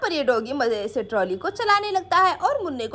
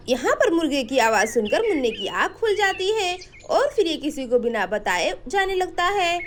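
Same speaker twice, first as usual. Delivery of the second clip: ordinary delivery, nothing marked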